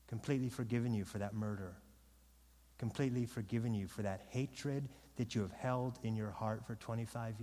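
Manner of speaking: soft, low, tender appeal; wooing. Shown as a demonstration. Preacher showing Peter pleading with sinners, soft wooing. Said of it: "Peter's wooing".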